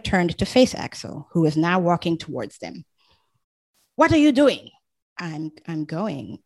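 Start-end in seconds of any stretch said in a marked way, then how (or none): 4.0-4.7 high loud angry challenge; angry demand. Acted. Husband confronts wife walking out, loud angry challenge. 5.2-6.4 soft, slow, faltering reply; timid. Acted. Wife answers her angry husband, soft and faltering.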